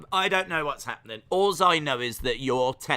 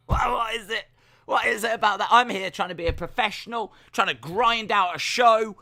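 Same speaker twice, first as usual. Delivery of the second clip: mock exasperation; 7 semitones higher, 5 dB louder than usual. Real, not acted. Host's mock exasperated rant.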